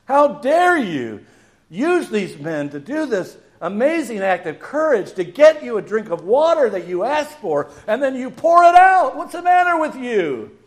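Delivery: high, loud indignation building to a shout; outraged rebuke. Acted. A shocked reader rebukes David for pouring out the water, outraged.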